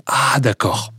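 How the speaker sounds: a soft, hushed first "Ah, d'accord" as it starts to click; quiet dawning realization. Retold or imitated. Guest miming a listener hearing the song finally take off, hushed surprise. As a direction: murmur it to yourself as the penny begins to drop. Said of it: "et tu dis".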